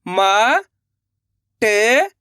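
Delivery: "मा", pause, "टे", each held long; letter by letter. Shown as a demonstration. Teacher has pupils read along, drawn-out separate "मा", "टे". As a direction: sound out "मा" and "टे" separately. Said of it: "एक एक चीज समझाने वाला हूँ".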